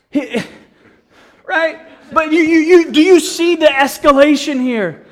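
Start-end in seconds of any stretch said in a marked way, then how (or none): 2.1-5.1 louder, higher, urgent; emphatic preaching. Real, not acted. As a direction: push the question at them. Preacher pressing congregation.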